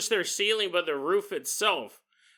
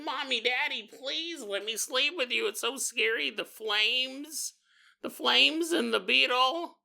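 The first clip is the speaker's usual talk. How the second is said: higher, lightly voiced scared little boy; pleading child; 7 semitones higher than usual. Philip begs his parents to let him sleep with them, lightly voiced scared child. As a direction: beg like a frightened small kid.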